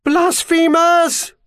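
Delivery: high, drawn-out crowd shout. A narrator's reading. Protester shouting at the oncoming Archbishop's guard. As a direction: cry it out loud and high as a war cry. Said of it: "Charles Dobbin shouted".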